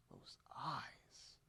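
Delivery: whispered in awe; smitten whisper. Acted. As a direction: breathe it out, lovestruck. Smitten aside about her eyes.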